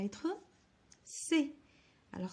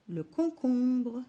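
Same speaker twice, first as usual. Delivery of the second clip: le concombre said slowly and louder; slow emphatic word; 4 semitones higher, 6 dB louder, slower than usual. Shown as a demonstration. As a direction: articulate concombre for children. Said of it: "teacher says the word slowly". (articuler concombre lentement)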